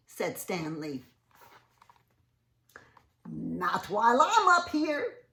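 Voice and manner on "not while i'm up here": loud, high ape voice; ape's voice. Acted. Ape in the tree shouting down at Stanley. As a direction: boom it out like a bossy ape.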